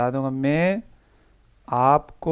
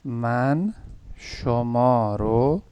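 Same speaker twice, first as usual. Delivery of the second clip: slow model sentence; much slower than usual. Shown as a demonstration. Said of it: "teacher models for student".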